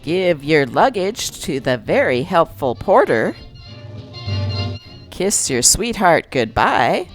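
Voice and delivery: ordinary talk, nothing marked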